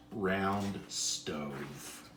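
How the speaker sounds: plain reading voice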